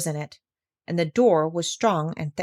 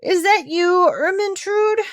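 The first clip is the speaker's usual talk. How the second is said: much higher drowsy king voice; sleepy king; 12 semitones higher, 5 dB louder, slower than usual. A narrator's reading. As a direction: mumble it half awake. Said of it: "said sleepily".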